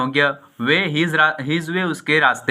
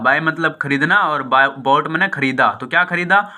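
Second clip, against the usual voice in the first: usual speaking voice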